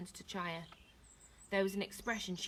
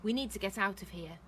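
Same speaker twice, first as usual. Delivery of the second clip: plain reading voice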